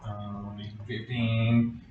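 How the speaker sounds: ordinary talk, nothing marked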